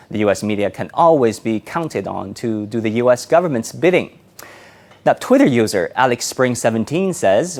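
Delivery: crisp, projected TV presenter delivery; news anchor read. Acted. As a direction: present the headlines with measured, emphatic authority. Anchors a live news show.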